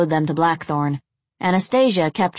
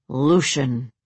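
surly vampire voice; scowling; 3 semitones lower than usual. A narrator's reading. Third vampire gives his name, scowling. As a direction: say it through a scowl. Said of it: "The third vampire said with a scowl".

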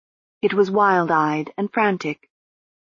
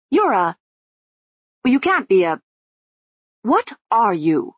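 demanding; 4 semitones higher than usual. Acted. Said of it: "she demanded".

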